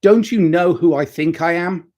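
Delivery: hammed-up superstar arrogance; mock self-important. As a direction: puff yourself up like a diva superstar. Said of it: "And he's like".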